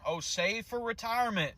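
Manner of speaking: mock the people who hand out easy advice; mocking quote. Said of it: "They tell us".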